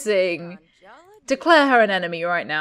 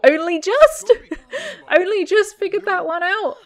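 mocking; 9 semitones higher, 3 dB louder than usual. Real, not acted. Mocking sim.

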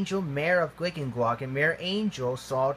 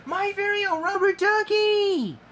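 very high, thrilled exclamation; crying out; 4 dB louder than usual. A narrator's reading. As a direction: cry out with high-pitched joy. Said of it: "he cried".

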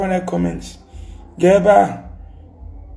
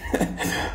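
breathy laugh; 11 dB quieter than usual. Real, not acted. Laughs at own rant.

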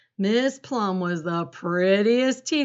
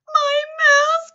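very high, loud wail; child's high-pitched shout of dismay; distressed cry; 9 dB louder than usual. A narrator's reading. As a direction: cry out for your lost pet. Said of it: "cried Sophie".

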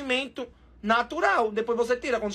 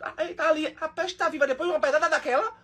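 ordinary talk

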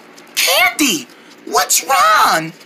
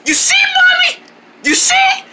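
anxious shout; 11 semitones higher than usual. Acted. Showing Mommy the spider.

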